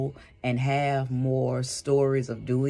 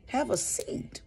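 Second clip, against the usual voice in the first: high breathy whisper of "Have a seat"; 11 semitones higher, 4 dB quieter, slower than usual. Shown as a demonstration. Repeating her advice to sit down, whispered.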